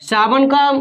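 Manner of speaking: ordinary talk, nothing marked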